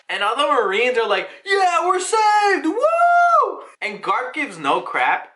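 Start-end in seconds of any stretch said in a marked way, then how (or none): 1.4-3.6 cheering whoop. Retold or imitated. Marines cheering rescue.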